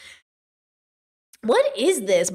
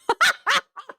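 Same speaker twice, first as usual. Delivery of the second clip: squeaky high burst of laughter; 12 semitones higher than usual. Real, not acted. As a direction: let out a helpless high laugh. Reacting to lyrics.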